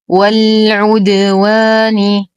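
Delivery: والعدوان recited slowly with a clear open و; slow tajweed recitation. Shown as a demonstration. Teacher recites the word for students, slow measured chant. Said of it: "ننتقل من فتحة الواو".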